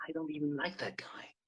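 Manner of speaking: inner thought. Acted. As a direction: mutter it to himself, unheard.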